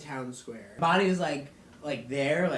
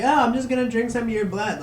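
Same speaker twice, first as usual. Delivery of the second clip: high, loud, quick and offhand; mock-casual, pitched up and hurried; breezy villain; 8 semitones higher, 10 dB louder, faster than usual. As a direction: toss off a creepy plan cheerfully. Silas breezily explains, acted.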